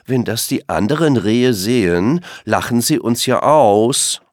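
lauter, empört spottend; spöttisch. Acted. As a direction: andere vor Blamage warnen. Langbein spottet weiter. (Langbein empört sich laut)

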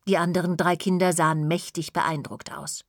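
ordinary delivery, nothing marked

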